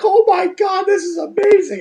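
high, thrilled gush of wonder; out of my mind. Retold or imitated. On a glass-bottom helicopter ride, over-the-moon excitement. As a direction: gasp it out like a kid on a ride. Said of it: "I'm out of my mind".

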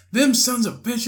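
high-pitched indignant cursing; imitated angry lady. Lady answering the puzzled bystander, high indignant mock cursing. Lady repeats her curse, retold.

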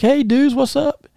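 high, breezy "hey dudes" imitation; lightly imitated cheerful wrestler; mock surfer-dude greeting. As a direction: greet them like a laid-back party dude. Wrestler Texas Ranger greeting them.

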